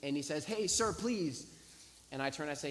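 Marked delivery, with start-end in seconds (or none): 0.4-1.5 high, drawn-out plea to a stranger; pleading call. Retold or imitated. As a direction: imitate a man begging someone to wait. Man calls him back, begging.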